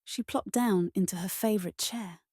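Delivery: ordinary delivery, nothing marked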